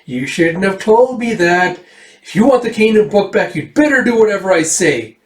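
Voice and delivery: put-on high, taunting child voice; gloating high-pitched little blackmailer; threatening girl. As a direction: lord it over them in a girlish pitch. Erica threatens him with leverage.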